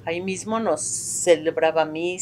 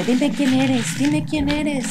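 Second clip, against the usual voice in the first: priest demanding; 7 semitones higher, much slower than usual. Retold or imitated. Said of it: "Y él le decía".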